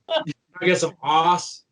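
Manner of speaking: slow, drawn-out Kentucky country twang; lightly mocking Kentucky country accent, slow. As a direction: draw out "ass" in a thick Kentucky twang. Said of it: "the country twang that comes out".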